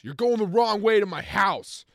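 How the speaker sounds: full-volume high outburst; imitated furious drunk yelling; drunken yell. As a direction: scream it from the backseat. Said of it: "he yells".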